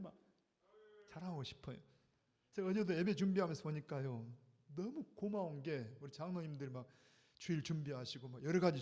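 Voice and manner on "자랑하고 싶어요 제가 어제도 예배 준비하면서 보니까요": ordinary talk, nothing marked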